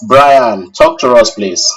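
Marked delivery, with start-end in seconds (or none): none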